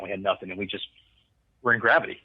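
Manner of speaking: ordinary delivery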